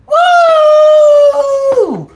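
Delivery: very high, loud woo; whooping shout. Real, not acted. Cheering for weight loss food with a loud whoop. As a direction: cheer at the top of your voice.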